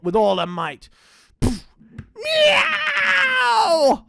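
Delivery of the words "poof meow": very high, loud pained yowl; howl of pain. Acted. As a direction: cry out as if struck. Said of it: "hollowed the dingo in pain".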